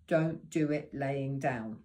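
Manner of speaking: ordinary talk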